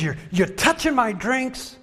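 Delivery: high, indignant imitation; mock ranting. Angry customer about his touched drinks, mock ranting. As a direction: raise the pitch in hammed-up indignation. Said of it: "he's ranting and he's raving".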